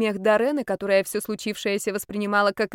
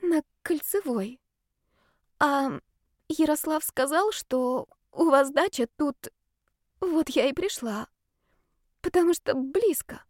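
timid; 7 semitones higher than usual. Acted. Shy girl lies hesitantly.

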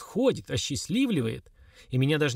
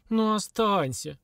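slow, higher pleading to stay; a drawn-out beg to the girl; 7 semitones higher, much slower than usual. A narrator's reading. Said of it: "умоляющим тоном взывала". (хозяйка медленно и умоляюще просит девушку остаться)

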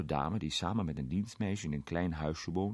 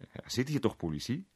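lifted, lightly amused question; chuckling; 6 semitones higher than usual. A narrator's reading. Arthur pointing to the inspector in the pub, chuckling. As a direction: ask it with a small chuckle. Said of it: "zei Arthur grinnikend".

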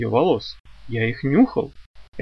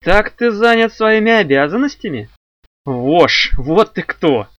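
raised, louder voice of an offended woman; jealous outrage; 6 semitones higher, 5 dB louder, slower than usual. A narrator's reading. Lili confronts Archie over his so-called duties, jealous outrage. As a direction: confront him angrily, pitched up and loud at first.

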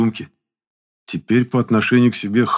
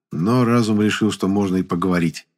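plain reading voice

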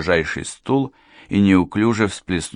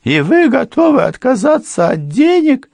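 much higher, loud disbelief; can't believe anyone refuses money; astonished question; 8 dB louder than usual. A narrator's reading. Housekeeper amazed at the lawyer, high astonished question. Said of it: "удивилась Мария".

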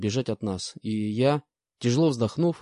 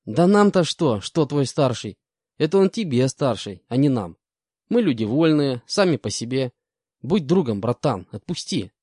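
good-natured con; 3 semitones higher, 4 dB louder than usual. Acted. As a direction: sweet-talk your way out, crook style. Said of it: "добродушно улыбнулся бес".

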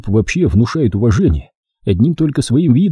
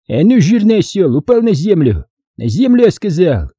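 high-pitched bossy command; грубый приказ; 8 semitones higher than usual. A narrator's reading. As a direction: order him down to the ground. Боец приказывает упасть на землю. (грубый приказ повышенным голосом)

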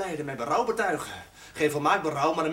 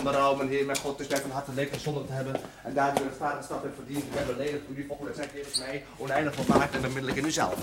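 softer, murmured act of contrition; recited prayer; 5 semitones lower, 5 dB quieter than usual. Acted. René reciting contrition to the chaplain, murmured. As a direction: rattle off the prayer by rote.

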